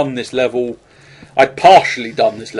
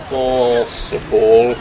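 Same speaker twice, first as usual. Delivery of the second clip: drawn out; much slower than usual. Shown as a demonstration. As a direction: stretch the word possible very slowly. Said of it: "if I extend every word as long as".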